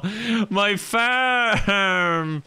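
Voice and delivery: high, drawn-out "no" over the farm; mock anguished wail. Farmer crying out over his farm, mock anguished wail. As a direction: wail it long and high, half laughing.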